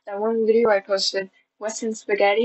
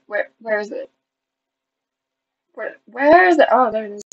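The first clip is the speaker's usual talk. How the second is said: ordinary delivery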